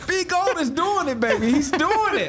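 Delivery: high, giddy cheering through laughter; laughing excitement. Real, not acted. Cheering for the running back.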